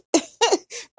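bursting into a high laugh; high giggle. Real, not acted. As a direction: crack up at the joke. Laughs at her own retort.

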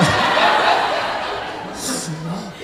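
a long, breathy, open laugh. Real, not acted. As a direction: laugh out at your own joke. Laughs at own accent joke.

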